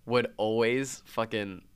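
usual speaking voice

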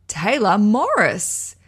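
slow, loud drawn-out reveal; grand announcement. Real, not acted. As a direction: announce the winner proudly. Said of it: "winner announcement".

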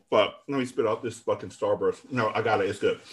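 talking through a mouthful of candy; words round a chewy sweet; mouth full. Real, not acted. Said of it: "My mouth is full".

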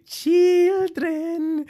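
high, drawn-out creepy lure; creepy Child Catcher. Retold or imitated. Podcaster imitates the creepy film villain luring children, high creepy call. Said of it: "He's so creepy".

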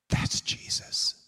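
slow, breath-only voicing of a dawning recognition; hushed realization. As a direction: imitate a disciple's stunned whisper. Disciple's hushed recognition, retold.

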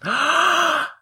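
high staged gasp; dramatic gasp. Acted. Player reacting to the name with a hammed-up gasp. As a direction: gasp like the twist just landed.

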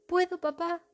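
high eager rising plea; pleading child voice. Acted. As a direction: beg like an excited little girl. Princess begs her father.

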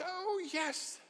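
high, overeager "oh, yes"; forced polite enthusiasm. Retold or imitated. Guest answering the host about the food, overeager politeness. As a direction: fake delight to please the host.

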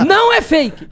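high, loud protest through laughter; laughing shout. Real, not acted. Answering critics who say his tics are fake, laughing shout. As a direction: insist it out loud while laughing.

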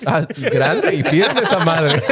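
slow, laughing mock appraisal; laughing, mock impressed. Retelling his drunken discovery, slow laughing mock praise.

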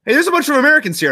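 high, fast, loud outcry; mock alarm. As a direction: act out a startled soldier raising the alarm. Soldiers discover the enemy inside.

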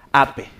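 "ape" as two syllables, "ah-peh"; mispronounced ape. Retold or imitated. Student naming the little monkey, Spanish-style mispronunciation. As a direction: read "ape" by Spanish spelling rules. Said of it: "ya no le digas ape".

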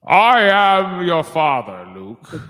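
slow, loud menacing villain voice; Darth Vader. Pretend. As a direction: boom it out slowly like a villain. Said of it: "Star Wars quote".

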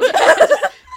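very high loud laugh; shrieking laugh. Real, not acted. Laughing after shush.

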